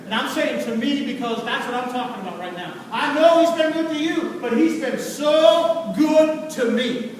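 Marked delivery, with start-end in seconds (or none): 2.9-7.1 loud, stretched, emphatic testimony; full-force preaching. Real, not acted. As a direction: punch out each declaration of gratitude.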